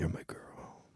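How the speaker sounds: very soft, playful endearment; intimate whisper. Pretend. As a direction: whisper it close to the ear.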